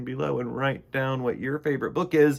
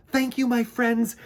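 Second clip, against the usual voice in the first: higher, louder happy bee voice; cheerful buzzing thanks; 10 semitones higher, 6 dB louder than usual. Acted. Bee Mister Bumble thanking the fairies while they wave goodbye. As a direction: thank them brightly. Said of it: "he buzzed".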